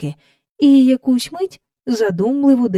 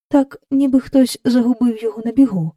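plain reading voice

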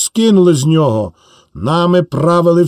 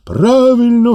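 high cry of agreement; crowd shout; 8 semitones higher than usual. Acted. The crowd shouting back to the speaker, high. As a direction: call it out like the crowd. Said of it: "гукає народ".

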